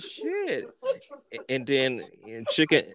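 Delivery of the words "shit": very high quiet gasped curse; high shocked shit. Retold or imitated. High shocked curse as the tree nearly comes down. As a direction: squeak the curse out.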